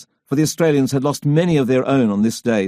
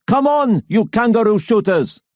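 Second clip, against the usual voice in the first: put-on shouted German accent; 9 semitones higher than usual. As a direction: bellow it in a thick German accent. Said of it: "shout across the trenches in his thick accent".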